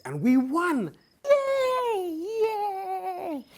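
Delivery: high, loud squeals of joy; high, bright victory whoops; excited cheer. Real, not acted. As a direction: cheer it like you just won. Host celebrates a trophy win.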